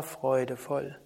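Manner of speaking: ordinary talk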